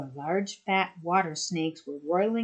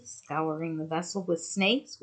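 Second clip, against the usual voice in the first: usual speaking voice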